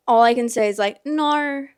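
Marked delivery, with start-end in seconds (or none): 1.1-1.7 one Australian-accented word, pitched up; Australian accent attempt. Shown as a demonstration. Showing viewers the only Australian accent they can do, Australian accent attempt. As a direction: try a token Australian "no". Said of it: "that's my extent of an Australian accent".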